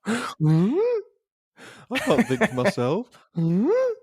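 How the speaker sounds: put-on high, vacant teen grunting huh; mocking a gormless teen behind a counter; dopey teenager. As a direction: play a blank, clueless teenager worker. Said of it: "teenagers like this".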